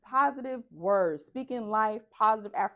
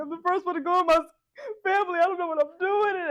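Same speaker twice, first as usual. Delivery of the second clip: high-pitched hammed-up tearful wail; mock crying; 8 semitones higher, 4 dB louder than usual. Student pleading to advisor about failing, mock crying. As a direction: imitate yourself bawling in a panic. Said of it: "I just started crying".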